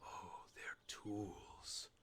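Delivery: low, hushed storyteller read of game lore; solemn narrator voice for ancient lore; lore narration. A narrator's reading. Reads in-game lore text aloud.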